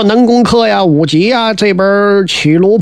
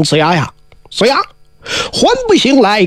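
a ringing summons to the sleeper; calling out; 2 semitones higher than usual. A narrator's reading. Chijingzi calls Ziya's soul back into his body. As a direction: call his name to wake him. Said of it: "叫了一声".

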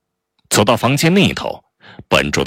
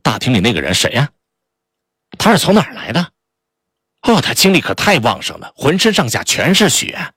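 high, quick, excited gossip; thrilled, nosy chatter; gleeful, piercing; 5 semitones higher than usual. Acted. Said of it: "低声但富有穿透力地问道".

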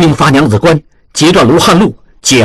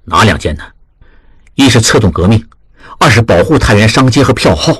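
lower, steady voice of a senior manager giving orders; lower, serious old boss; grave elder master; 5 semitones lower than usual. A narrator's reading. Master questions his apprentice.

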